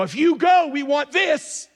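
put-on the girls calling after their parents, insisting; demanding daughters. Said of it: "so they go".

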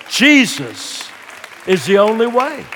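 loud, high-pitched preacher's proclamation; full-force preaching. Real, not acted. Preacher urging people to turn to Christ, full force.

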